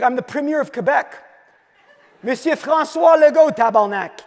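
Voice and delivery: hammed-up Quebecois French accent, laughing; French accent impression. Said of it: "in a French accent".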